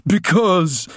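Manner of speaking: high, loud snort of a word. Acted. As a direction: scoff it at the little girl. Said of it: "snorted Ginger".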